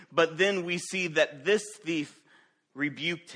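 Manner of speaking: loud, driving sermon delivery; fervent preaching. Acted. Pastor to congregation, preaching loud and fervent. Preacher building through opening points.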